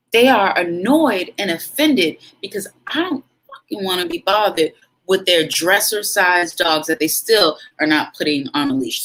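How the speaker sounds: usual speaking voice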